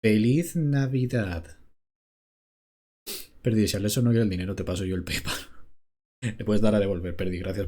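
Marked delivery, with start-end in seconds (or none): 0.0-1.6 slow, drawn-out robot voice. Pretend. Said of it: "yo con voz de robot".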